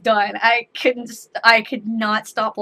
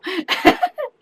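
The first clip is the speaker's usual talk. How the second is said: real laugh; 12 semitones higher than usual. Real, not acted. Laughing at the cute kid.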